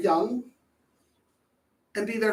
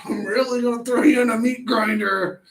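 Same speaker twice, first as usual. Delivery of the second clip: hammed-up frustrated parent yelling in jest; exaggerated temper, light shout; mock angry parent. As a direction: fake rage, plainly joking. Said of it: "There's that temper".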